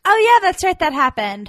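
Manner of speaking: high, loud burst of recognition; excited recall. Real, not acted. Co-host suddenly remembering the film's ending, excited. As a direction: blurt it out as it comes back to you.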